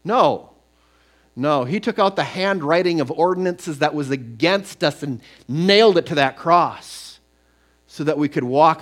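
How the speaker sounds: fervent preaching crescendo; emphatic no. Real, not acted. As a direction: refute it boldly. Preaching at full force.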